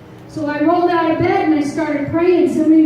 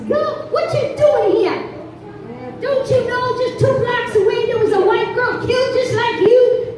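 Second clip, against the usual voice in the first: gruff officer voice, raised pitch; alarmed policeman warning her; stern cop; 8 semitones higher than usual. Retold or imitated. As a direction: bark it like a suspicious cop. Cop confronting her at night.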